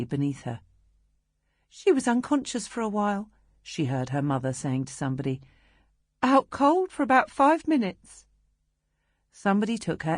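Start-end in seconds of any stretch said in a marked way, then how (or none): 1.7-3.3 mother voice. Acted. 6.2-8.3 higher, louder worried mother. Acted. Mother voiced in story.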